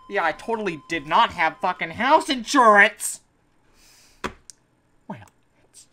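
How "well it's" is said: deflated whisper. Real, not acted. Defeated mutter.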